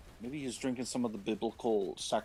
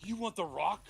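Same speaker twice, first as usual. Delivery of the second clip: mock caveman; 7 semitones higher than usual. Caveman Cain voiced.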